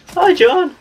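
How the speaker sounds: very high, loud sing-song mum greeting; mum calling out. Retold or imitated. Mum passing the shop calls to her son, very high and loud. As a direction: squeal it like a cheery mum. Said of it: "and it's like".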